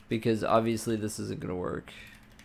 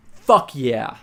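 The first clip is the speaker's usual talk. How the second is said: a real burst of loud hype; big excited yell of triumph; 9 dB louder than usual. Real, not acted. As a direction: shout it out, suddenly pumped. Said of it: "Had to get a little bit hype there".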